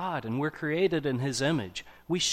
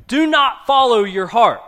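a preacher's loud rebuke of the advice; preaching at full force; 9 semitones higher, 13 dB louder than usual. Real, not acted. Preacher repeats the key warning.

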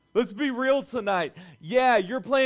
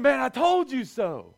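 higher, hammed-up gloat; gloating God; 6 semitones higher, 5 dB louder than usual. God teasing the doubters on dry land, mock gloating. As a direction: rub it in playfully. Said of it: "He said".

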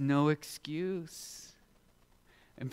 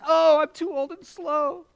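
put-on high, slow old-man whine; mock pitiful old man; whiny old Lot; 6 dB louder than usual. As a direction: complain like a feeble old man. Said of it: "And Lot says".

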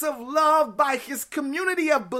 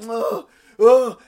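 tired groan; 3 semitones lower, 7 dB louder than usual. Retold or imitated. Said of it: "I'll be like".

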